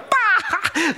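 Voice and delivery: a sudden high barking laugh; hyena cackle. Retold or imitated. Hyenas mocking the little lion cub, high cackling laugh.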